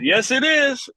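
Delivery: high, emphatic mock call; retold called-out comeback. Player answering the umpire's question, high called-out retort.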